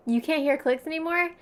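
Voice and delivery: ordinary talk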